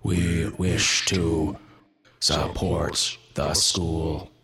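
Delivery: very slow, otherworldly creature voice; eerie Wendigo voice. Acted. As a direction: drag each word out like something inhuman. Wendigo answers the townsfolk.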